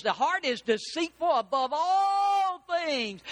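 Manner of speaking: slow, high, full-voiced proclamation; preached shout. Preacher to congregation, declaiming scripture in a slow high shout. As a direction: thunder the verse out to the congregation.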